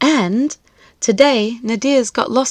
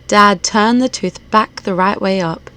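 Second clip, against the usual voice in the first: plain reading voice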